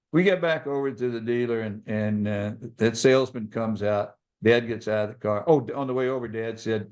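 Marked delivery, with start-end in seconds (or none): none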